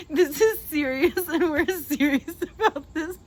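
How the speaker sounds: mock-serious words breaking into laughter, slow; trying to sound serious but cracking up; laughing through it. Real, not acted.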